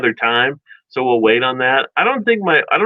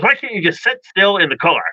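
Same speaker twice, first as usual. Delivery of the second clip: mock exasperation, pitched up; irritated mom; 7 semitones higher than usual. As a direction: imitate a mother at the end of her patience. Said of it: "it irritated my mom".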